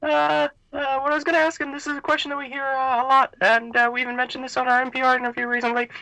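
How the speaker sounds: very high squeaky puppet voice; David ventriloquist puppet. Pretend. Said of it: "I'm gonna bring out the, uh, the David ventriloquist puppet".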